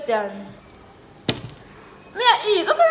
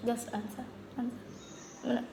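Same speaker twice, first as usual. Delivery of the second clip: whispered urging under breath; 15 dB quieter, slower than usual. Real, not acted. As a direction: hiss the word answer quietly.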